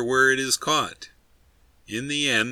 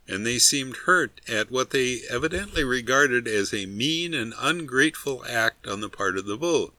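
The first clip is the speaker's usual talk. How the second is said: usual speaking voice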